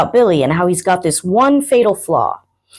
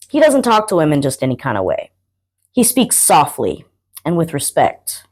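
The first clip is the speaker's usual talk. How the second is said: plain reading voice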